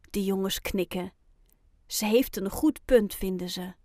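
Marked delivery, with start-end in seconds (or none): none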